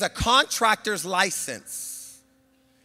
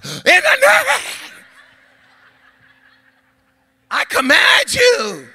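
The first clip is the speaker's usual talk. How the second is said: much higher, loud, slow command; louder command; 8 dB louder, slower than usual. Shown as a demonstration. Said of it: "Now say it louder".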